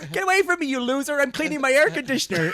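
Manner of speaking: high, loud hammed-up brush-off; mock angry rejection. Performer re-enacting the mix to loser, mock outrage. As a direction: reject them loudly, pitched high, near laughter.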